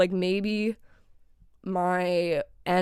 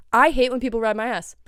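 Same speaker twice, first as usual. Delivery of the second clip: ordinary talk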